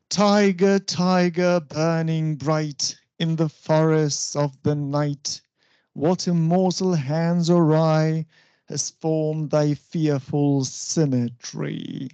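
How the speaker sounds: slow, measured verse, first syllable of each foot stressed; deliberate falling beat, very unhurried; trochaic recitation. Shown as a demonstration. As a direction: recite "Tiger, tiger, burning bright" STRONG-weak, drawn out. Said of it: "so this is trochee".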